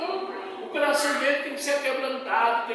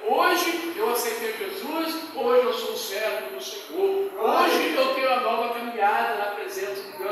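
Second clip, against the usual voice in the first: fervent preaching; 6 dB louder than usual. Real, not acted. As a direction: proclaim it with fervour. Preaching testimony at full force.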